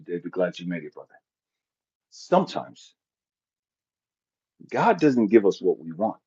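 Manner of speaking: soft, low sermon murmur; mock preacher. Preacher greeting his congregation, hushed and low.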